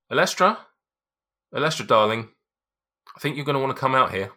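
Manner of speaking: high, loud call over the shoulder; husband calling his wife to the door, loud. Acted. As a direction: call back into the house to your wife. Calls wife from behind.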